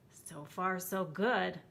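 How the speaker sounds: usual speaking voice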